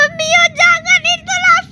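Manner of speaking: high alarmed plea; pleading. Pretend. Being pushed toward lava, high alarmed plea. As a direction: beg her to stop.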